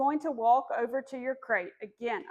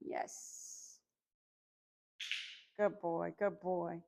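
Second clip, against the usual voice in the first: soft praise; 5 semitones lower, 8 dB quieter, much slower than usual. Real, not acted. Praising puppy after recall.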